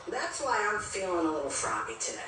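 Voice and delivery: ordinary talk, nothing marked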